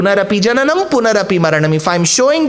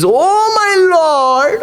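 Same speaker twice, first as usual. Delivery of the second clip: high, drawn-out, crying devotee voice; adoring devotee; 8 semitones higher, much slower than usual. Acted. Devotee gushing to the Lord, high and slow. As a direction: melt before the Lord, stretching every word. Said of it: "every devotee who goes in the presence of the Lord says".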